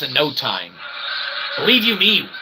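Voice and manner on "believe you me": ordinary talk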